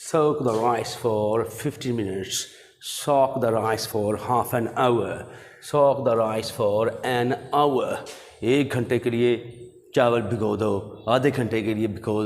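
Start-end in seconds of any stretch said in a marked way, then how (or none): 0.1-8.0 slow teaching read. Shown as a demonstration.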